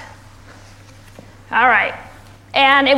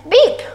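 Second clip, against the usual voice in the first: short, high imitated horn beep; a quick little toot, pitched high; truck beep; 8 semitones higher, 6 dB louder than usual. A narrator's reading. Little truck greeting animals, high short beep. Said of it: "Blue said".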